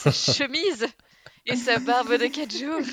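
playful swooning. Pretend. Co-host teased about an actor's charm, playfully swooning. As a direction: gush loudly, as if overcome by his charm. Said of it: "déstabilisé par son charme".

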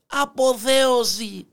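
high, slow, drawn-out call; crying out. Acted. Hare overheard by the passing lion, crying out. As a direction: cry it out long and high. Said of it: "και να φωνάζει".

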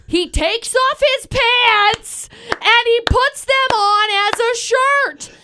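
high, drawn-out storyteller's climax; incredulous outburst. Real, not acted. Climax of her absurd story.

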